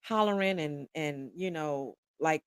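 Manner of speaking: plain reading voice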